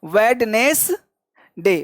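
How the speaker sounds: "वेडनेसडे" slowly, wed-nes-day; syllable-by-syllable demo. Shown as a demonstration. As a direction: stretch out each part of "वेडनेसडे" for pupils. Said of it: "तो इस तरह से निकालना". ("वेडनेसडे" धीरे-धीरे, हर हिस्सा साफ़)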